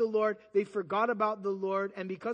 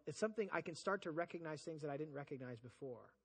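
usual speaking voice

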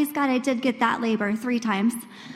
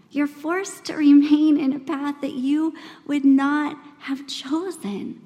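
ordinary talk

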